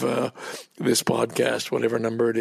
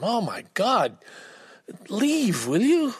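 exasperated inner plea, rising high; irritated; 8 semitones higher, slower than usual. Retold or imitated. Impatient man at the post office urging the old man to leave, exasperated. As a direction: huff it out at the slow man, climbing in pitch. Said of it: "I'm just irritated by him".

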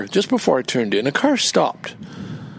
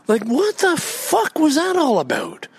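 indignant rant; 12 semitones higher than usual. Real, not acted. Ranting about the beggar driver.

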